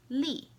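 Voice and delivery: "li" short, falling sharply from high; fourth tone. Shown as a demonstration. Teacher showing the tones, "li" falling. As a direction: drop "li" fast from the top. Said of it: "I'll say the four tones".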